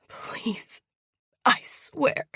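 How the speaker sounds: raspy whispered plea; hoarse breathless pleading; rasp. Acted. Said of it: "I rasp".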